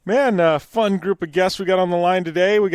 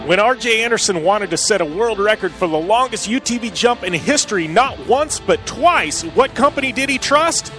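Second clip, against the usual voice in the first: ad read; 3 semitones higher than usual. Acted. Reads a sponsor ad.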